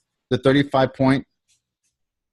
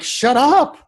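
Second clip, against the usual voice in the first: mock disbelief; 12 semitones higher, 3 dB louder than usual. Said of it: "I'm like".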